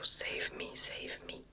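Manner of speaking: usual speaking voice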